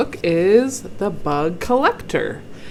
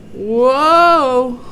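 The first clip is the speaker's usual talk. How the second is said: loud, higher, drawn-out exclamation; amazed whoa; 6 semitones higher, 10 dB louder than usual. Acted. Reader reacts to George's stunt in the picture, amazed exclamation. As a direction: let the wow swell.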